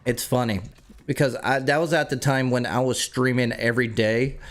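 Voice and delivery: ordinary talk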